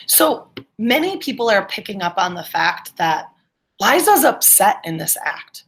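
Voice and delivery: ordinary delivery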